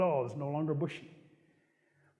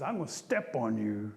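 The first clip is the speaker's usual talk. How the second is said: threatening Bear; 7 semitones lower than usual. Acted.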